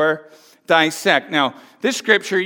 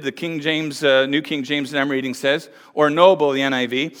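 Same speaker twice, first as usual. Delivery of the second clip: normal talking voice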